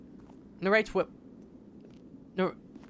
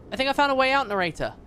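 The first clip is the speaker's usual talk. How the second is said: excited call, pitched up; 9 semitones higher, faster than usual. Real, not acted. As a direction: call out eagerly, thrilled. Player excitedly calls the narrator.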